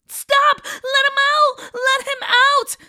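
high desperate scream at the witch. Acted. Sister screaming to free her brother, desperate shriek.

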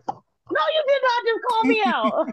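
high squealed retelling of her own shock; mock outrage. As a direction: act scandalized that he called you out. Retold shock at chef's wig joke.

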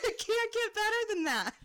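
words squeezed out through high laughter; laughing. Real, not acted. Host laughing with her cohost at the perfect story. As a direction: laugh through the whole line.

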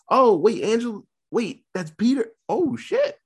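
high mock double take; retold shocked realization. Imitating viewers at the Angela twist, shocked. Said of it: "the twist at the end with Angela".

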